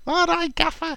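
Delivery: very high, squeaky mock voice; squeaky, eager answer to the boss; Kevin Nolan. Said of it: "Kevin Nolan at the sideline".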